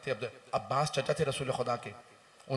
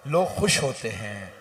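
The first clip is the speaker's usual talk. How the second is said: loud, high, slowed lament; full-force majlis preaching; 4 semitones higher, 13 dB louder than usual. Acted. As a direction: move the mourners to weep. Preaching grief to mourners. (ज़ोरदार, धीमा मातमी बयान)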